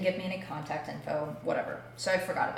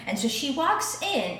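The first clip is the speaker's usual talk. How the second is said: ordinary talk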